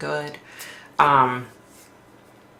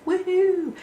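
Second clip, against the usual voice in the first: high, happy little woohoo; a quick high cheer at a jackpot; mock cheer. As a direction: squeal it like a lucky win. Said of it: "I'm like".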